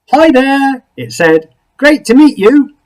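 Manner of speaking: high, loud cheery creature voice. Acted. Creature greets Something Else at his door, high and cheery. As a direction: bounce in like an overfriendly little creature.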